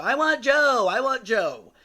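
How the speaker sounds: high, loud drawn-out wail; imitated patient's demanding yell; patient calling out loudly. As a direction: holler it across the building, falling at the end. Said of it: "you could hear her literally".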